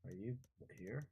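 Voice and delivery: ordinary talk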